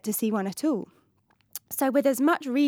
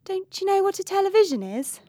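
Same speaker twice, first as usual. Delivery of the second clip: mocking; 8 semitones higher, 3 dB louder than usual. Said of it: "So now she was mocking him".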